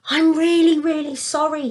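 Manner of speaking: high, pleading apology; pleading cry. Acted. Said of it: "he cried".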